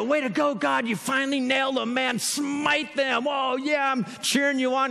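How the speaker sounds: hammed-up gloating cheer; mock excited cheering. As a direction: egg God on like a sports fan. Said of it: "we're all excited".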